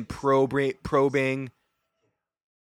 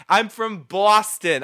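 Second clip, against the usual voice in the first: Boston accent; 6 semitones higher, 6 dB louder, slower than usual. Real, not acted. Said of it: "I add R's to everything".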